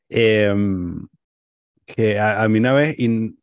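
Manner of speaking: normal talking voice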